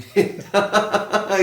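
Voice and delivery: real laugh at a witty remark; amused laugh. Real, not acted. Co-host laughs at mother remark. (محظوظ ہو کر ہنسی)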